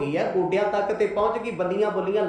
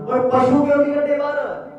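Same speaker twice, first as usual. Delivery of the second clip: higher, louder scolding; angry father; 7 semitones higher, 5 dB louder than usual. Acted. Father scolding his son for letting cattle graze. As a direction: snap at the son over the cattle. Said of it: "ਗੁੱਸਾ ਚੜਿਆ".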